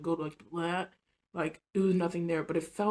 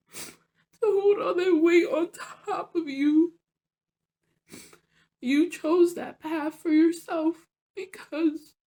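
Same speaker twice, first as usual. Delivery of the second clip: tearful; 7 semitones higher, 5 dB louder, slower than usual. Real, not acted. Storyteller recalling the weight she chose to hold, tearful. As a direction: voice breaking, high and loud. Tearful retelling of painful inner message.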